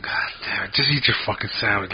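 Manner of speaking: half-whispered irritated curse, kept to yourself; retold muttered anger. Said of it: "It pissed me the fuck off".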